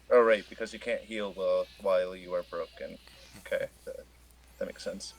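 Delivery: ordinary talk, nothing marked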